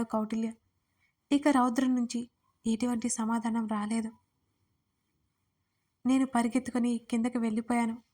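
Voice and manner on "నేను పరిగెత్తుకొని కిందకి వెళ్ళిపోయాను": plain reading voice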